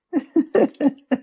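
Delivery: high-pitched burst of laughter. Real, not acted. Laughs after the joke.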